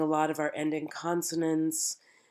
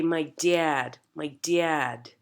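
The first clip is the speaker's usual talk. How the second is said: Buffalo dad, vowel gliding like dee-ad; Buffalo accent; much slower than usual. Shown as a demonstration. Coach showing Buffalo speakers their own distinction, Buffalo accent. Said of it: "standard Buffalo accent".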